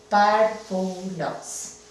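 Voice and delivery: Párvulos said slowly, syllable by syllable; slow syllable split. Shown as a demonstration. Teacher dividing the word for the class, slow syllables. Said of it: "La vamos a dividir".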